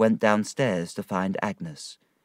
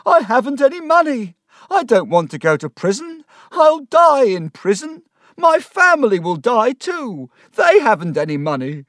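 panicked wailing; 13 semitones higher, 8 dB louder than usual. Acted. Micawber panics about prison.

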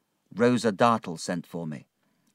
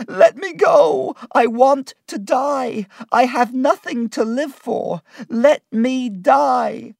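high, loud, drawn-out anguished pleading; desperate crying; 9 dB louder, slower than usual. A narrator's reading. Martha begs to die as she is held, desperate crying. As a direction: wail and beg to be released. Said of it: "She began to cry".

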